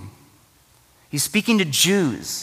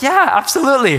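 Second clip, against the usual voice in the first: yeah pitched up high and loud, all in; eager yes; 10 semitones higher, 6 dB louder, faster than usual. Retold or imitated. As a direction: jump in with a hearty yeah, absolutely. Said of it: "No, I was like".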